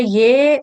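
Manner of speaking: ये held on a long e; yeee, a sustained ये; long vowel. Shown as a demonstration. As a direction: stretch ये out as one syllable. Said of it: "ये जो है ये भी दीर्घ है".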